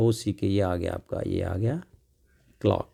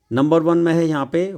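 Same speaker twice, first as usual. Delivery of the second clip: usual speaking voice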